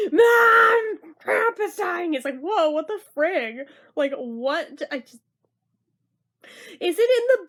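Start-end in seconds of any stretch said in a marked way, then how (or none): 0.1-2.1 high, loud mock shout to mom; kid crying out. Kid calls to mom about grandpa, alarmed shout. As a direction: cry out in alarm like the panicked kid. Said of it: "the kid knew".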